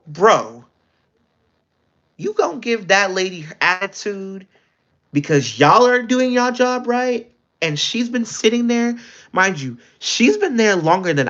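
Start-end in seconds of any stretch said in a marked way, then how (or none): 0.1-7.3 higher, indignant telling-off; indignant retort. Retold or imitated. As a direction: call her out for being rude. Telling off a rude worker.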